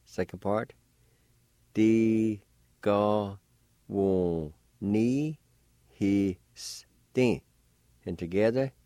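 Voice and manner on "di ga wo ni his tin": "Di-ga-wo-ni-his-tin" very slowly, each syllable held; syllable-by-syllable pronunciation. Shown as a demonstration. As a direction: sound out "Di-ga-wo-ni-his-tin" with pauses between syllables. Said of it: "pronounced like this".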